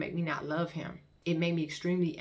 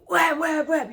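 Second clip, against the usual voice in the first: high, loud startled what; startled confusion; 11 semitones higher, 10 dB louder, slower than usual. Retold or imitated. Max popping up confused after passing out, startled. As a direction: blurt it out, just jolted awake. Max jolts back to consciousness.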